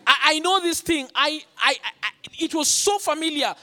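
high, loud, stammering alarm; alarmed recognition. Retold or imitated. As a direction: relive a moment of intense fear. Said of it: "The intense fear".

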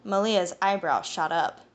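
ordinary delivery, nothing marked

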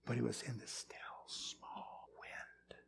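slow, very soft whisper; hushed whisper. Acted. Preacher saying God was not in the fire, whispered. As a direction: sink into a drawn-out whisper. Contrasting loud displays with still wind.

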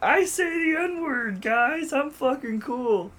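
high, slow mocking girl voice; mock funny girl. Mocking a try-hard girl bragging to her friends, high and slow. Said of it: "She says the".

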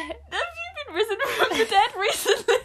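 high-pitched cold-caller voice, laughing; hammed-up call-centre voice; mock salesperson. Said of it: "Why do you sound like something like".

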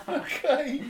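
giddy with nerves, okay squeaked out through a laugh; nervous laughing okay. Retold or imitated. Nervous laughing reply to Herbie.

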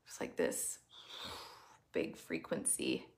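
soft, unvoiced whisper; hushed whisper. Real, not acted. Host confiding the big frequency to the group, whispered. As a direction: drop to a secret whisper.

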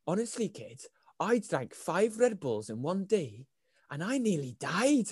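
soft, high, slow Welsh impersonation; Welsh accent. Retold or imitated. Stranger recalls five Red Bulls, put-on Welsh accent. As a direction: confide it in a lilting put-on Welsh voice. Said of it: "he was Welsh".

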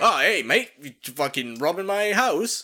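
lightly voiced British accent, laughing; a hint of a Cockney homeowner. Homeowner confronting raiding police, a light British accent. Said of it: "I don't know why he's British".

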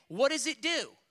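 ordinary talk

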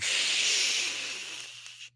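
long hissing spray noise; drawn-out spritz sound, tapering off; imitated aerosol spray. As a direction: sustain an unvoiced "shh" like a can emptying. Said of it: "It was like".

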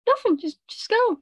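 high, guilty brush-off; flustered sister. Retold or imitated. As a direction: squeak it like someone hiding something. Sister shooing narrator away.

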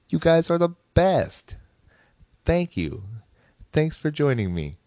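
high, bright, delighted thanks; gushing. Real, not acted. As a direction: squeal with happy gratitude.

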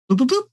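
high popping bloop sounds. Acted. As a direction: pop them out, bloop bloop. GM repeats duplicate sound effect.

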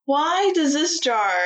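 high, slow drawn-out complaint; mock exasperation. Real, not acted. Host protests her co-host's lucky draw, mock exasperated. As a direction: stretch it out in playful protest.

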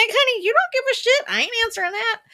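high, loud mock-sassy snap, far above her own voice; sassy diva retort. A high, loud sassy brush-off to people who don't care. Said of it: "I'm like".

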